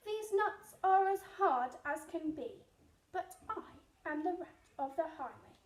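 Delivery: loud snarling robber rat. Acted. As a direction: snarl the words at the squirrel. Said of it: "and snarled".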